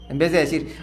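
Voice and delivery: ordinary delivery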